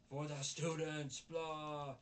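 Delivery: loud, high, drawn-out mock proclamation; mocking slogan. Reviewer mocks the organization's motto, mocking slogan.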